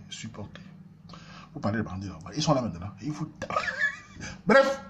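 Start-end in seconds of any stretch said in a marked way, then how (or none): 3.6-4.4 sudden high-pitched burst of laughter; high squealing laugh. Real, not acted. Burst of laughter mid-story.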